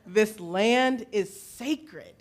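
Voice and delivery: slow, deliberate spoken phrase; feed the words slowly for a group to echo; repeat-after-me line. Shown as a demonstration. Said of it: "repeat after me sort of thing".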